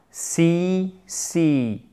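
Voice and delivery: Si said with the same long ee as see; Si modelled like English see. Shown as a demonstration. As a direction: whisper Si as a sound demo. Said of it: "Ça se prononce exactement de la même façon".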